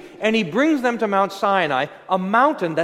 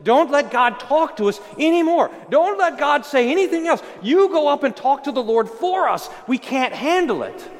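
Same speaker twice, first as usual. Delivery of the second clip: terrified plea; 7 semitones higher than usual. Acted. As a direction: beg to be spared, voice raised in fear. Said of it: "And they said to Moses".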